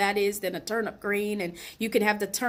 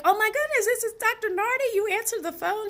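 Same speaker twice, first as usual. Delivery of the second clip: much higher, squealing with disbelief; starstruck surprise; 12 semitones higher, faster than usual. Retold or imitated. Caller amazed the doctor answers his own phone: squealing surprise. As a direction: gush like a starstruck fan. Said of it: "I'm like".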